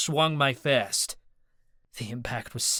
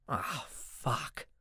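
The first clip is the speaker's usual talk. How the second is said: muttered curse; 10 dB quieter than usual. A narrator's reading. Narrator curses under breath.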